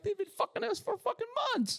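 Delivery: squeaky, high-pitched whine; very high mock complaint. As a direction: whine it like an outraged customer. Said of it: "And they're like".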